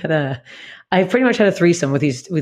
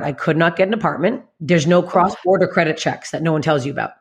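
normal talking voice

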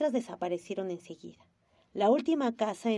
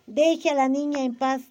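much louder, higher annoyed order; 4 semitones higher, 8 dB louder than usual. Acted. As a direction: snap at her to stop.

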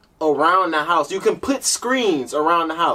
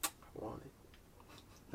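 whispered longing; 10 semitones lower, 31 dB quieter, much slower than usual. Acted. Craving gadget, whispered.

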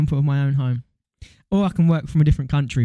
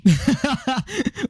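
high laugh; 10 semitones higher than usual. Real, not acted. Laughing at reply.

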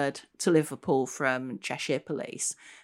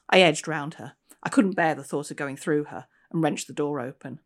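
measured, storytelling read-aloud voice; story narration; 2 semitones higher, 3 dB louder than usual. A narrator's reading. Author reading her novel aloud to an audience. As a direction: draw listeners into the haunted station.

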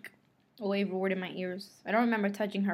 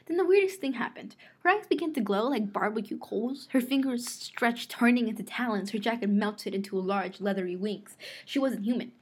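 ordinary delivery, nothing marked

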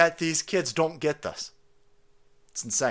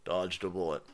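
dismissive dad; 8 semitones lower, 9 dB quieter than usual. Retold or imitated. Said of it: "is sitting there going".

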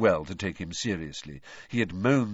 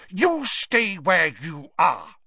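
slow, loud, higher snarl of a fierce mother; 8 semitones higher, 7 dB louder, slower than usual. A narrator's reading. Mother forbidding the twins to come along, snarling. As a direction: bite out every word, slow and hard. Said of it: "snarled Missus Weasley".

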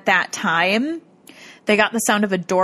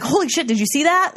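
retold startled cry, pitched higher; startled reaction; 6 semitones higher than usual. Investigators spot a shadow walk by, retold surprise. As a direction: exclaim it higher, imitating their surprise.

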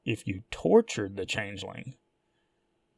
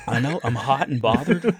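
giggling through the words; laughing while joking; 5 semitones higher than usual. Real, not acted. Laughing at teasing about daddy.

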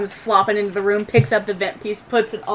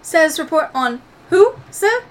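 high, formal droid voice; Proto; 6 semitones higher than usual. Acted. Game master's droid answering a status request, high and formal. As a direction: query politely in a raised, precise droid voice.

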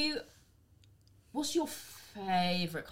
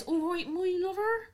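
higher, sing-song Bristolian accent; 11 semitones higher than usual. Shown as a demonstration. Showing her guest the Bristol accent, sing-song Bristolian. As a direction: greet them the Bristol way, lilting. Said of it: "that's Bristolian".

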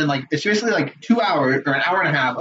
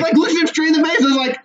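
usual speaking voice

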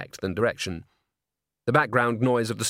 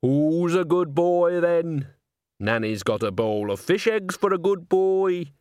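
put-on higher, drawn-out baby talk; coaxing a pet; 6 semitones higher, slower than usual. A narrator's reading. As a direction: coo at the cat to lure it out. Nanny coaxes her cat out.

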